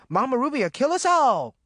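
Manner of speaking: high, panicked warning; screaming. A narrator's reading. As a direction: scream it out in terror. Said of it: "he screamed".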